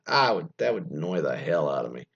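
ordinary talk, nothing marked

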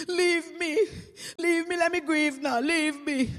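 hammed-up crying widow; mock bawling. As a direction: plead through put-on grief. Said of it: "she bawling".

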